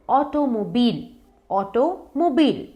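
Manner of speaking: ऑटोमोबाइल ending in "beel"; long "ee" in last syllable of ऑटोमोबाइल; correct pronunciation. Shown as a demonstration. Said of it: "इसका pronunciation है ऑटोमोबाइल".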